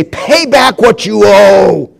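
slow, loud, seething demand; a servant throttling a debtor, shouting angrily; angry demand. Acted. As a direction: grab him and snarl for the money. Servant choking fellow servant.

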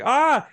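sharp, high cry of pain; startled yelp. Retold or imitated. Eddie thrown back by the explosion, sharp high yelp. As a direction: yelp as the blast throws him.

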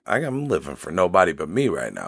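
low, subdued admission; said low, a little sadly. Real, not acted. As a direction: let it sink, soft and downcast. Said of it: "That sounded sad".

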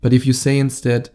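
ordinary talk, nothing marked

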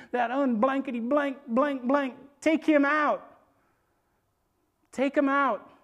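loud, high, punched-out order; mock cursing command. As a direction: act fired up and cursing. David swearing, ordering giant killed.

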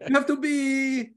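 Italian manager holding back a swear word; Italian accent. Retold or imitated. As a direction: drag it out like an Italian coach. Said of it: "no, he's like".

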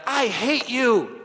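high, loud, slow angry yell; retold scream. Boy yelling at his angry dad, drawn-out shouted outburst. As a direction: fling the words at dad like a furious kid. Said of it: "I remember screaming these words to my dad".

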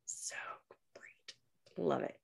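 breathy whispered gush; whispering. Real, not acted. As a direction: drop to a whisper. Gushing in a hushed aside.

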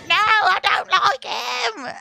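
high, slow whiny protest; upset child denial. Retold or imitated. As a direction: protest like a flustered little girl. Upset kid denying.

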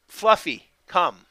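name called out, pause, then a firm command; firm but gentle. Shown as a demonstration. Dog trainer showing owners how to call Fluffy, firm but gentle. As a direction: get the dog's attention, then drop onto the command. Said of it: "in a firm but gentle tone".

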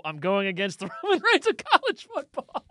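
breaking into laughter mid-line; amused quoting. Retold or imitated. Teasing the co-host with his own text, laughing.

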